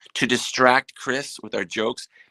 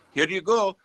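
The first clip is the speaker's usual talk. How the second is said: imitating the hat maker; 7 semitones higher than usual. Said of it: "He goes".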